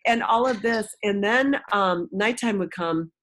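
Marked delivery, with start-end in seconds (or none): none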